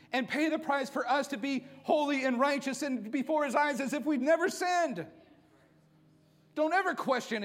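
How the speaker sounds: loud, high impassioned preaching; full-force preaching. Real, not acted. Pastor urging the church at full preaching force. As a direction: preach it out to the whole church, fervent.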